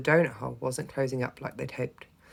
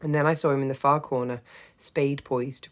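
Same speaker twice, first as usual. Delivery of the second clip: ordinary delivery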